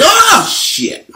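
sudden high-pitched yelp of alarm; startled curse. Retold or imitated. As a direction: jolt awake in shock, voice leaping way up. Waking from the peeing dream.

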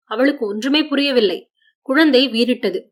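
ordinary delivery, nothing marked

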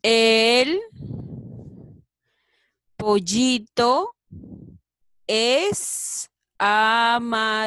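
loud, slow, word by word; slow syllabic reading. Shown as a demonstration. Teacher modelling a sentence for children, slow and loud. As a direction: read it out slowly to the class, word by word. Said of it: "A ver qué dirá, chicos".

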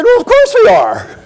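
high, put-on voice of the son; mock the son's offhand answer; high put-on son voice, of course we are; son's casual reply.

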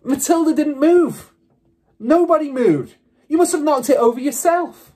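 high, loud protesting shouts; crying out. A narrator's reading. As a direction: call out in indignant defence. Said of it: "they cried out".

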